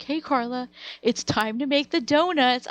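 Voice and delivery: big mock wake-up announcement; teasing older sister. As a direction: call it out like a gleeful, teasing sister. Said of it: "and she's like".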